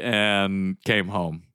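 ordinary talk

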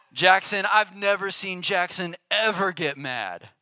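lightly hammed-up admirer; imitating amazed acquaintances. Imitating people marveling at him.

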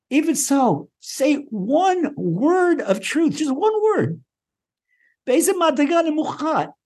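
high, rising preacher's fervour; impassioned sermon voice; fervent preaching. Acted. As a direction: preach it with mounting passion. Impassioned teaching on Rabbi Nachman.

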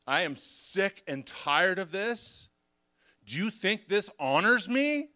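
loud, high, slow angry yell; retold yelling. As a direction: shout it out like the rage is boiling over. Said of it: "And I just start yelling".

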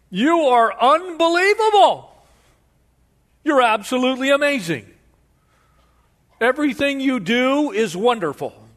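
high, loud fawning praise; gushing flatterer. Acted. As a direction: gush over the governor, over the top. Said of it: "You can almost see him, you know, do the, the bow".

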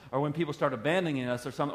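ordinary delivery

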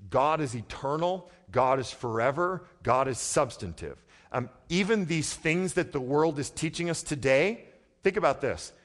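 loud, high, urgent preaching; impassioned sermon voice; full-force preaching. Acted. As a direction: preach it out to the back row. Preacher's raised, energetic delivery.

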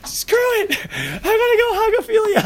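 mock eager viewer. Teacher imagines a viewer rushing to hug Ophelia, high laughing squeal. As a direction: squeal it like a fan rushing the stage. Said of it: "You're just like".

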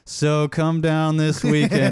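mock overacted radio spot; over-animated ad read. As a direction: push the ad over the top. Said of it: "they try it again".

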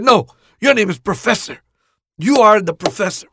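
loud and high, insisting on the new name; loud insistence. Retold or imitated. Boss tells the new hire his name, loud insistence. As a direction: proclaim it like a title.

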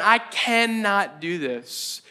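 drawn-out, emphatic "cannot"; slow it right down, stretching "cannot"; "cannot" dragged out in mock resignation; despairing refusal. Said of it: "that moment of going".

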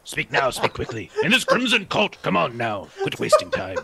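high, loud, impatient urging; impatient demanding. Acted. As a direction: hurry him along, exasperated. Impatient drunk lord demands.

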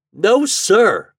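high, loud stubborn refusal; persistent. A narrator's reading. Toad refusing to give in to Chuck, persistent. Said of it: "persisted Toad".

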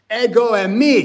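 loud ringing call of ego eimi; shout. Shown as a demonstration. Jesus shouting over the waves to the disciples, proclaimed shout. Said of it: "He shouts that one for sure".

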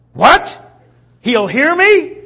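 high, very loud incredulous questions; astonished shout. Acted. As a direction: burst out in sudden hopeful disbelief. Beggar seizes on the crowd's warning.

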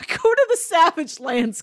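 ordinary talk

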